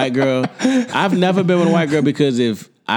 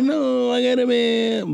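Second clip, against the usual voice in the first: mimicking a woman's brush-off; 11 semitones higher, slower than usual.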